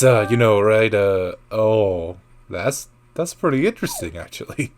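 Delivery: usual speaking voice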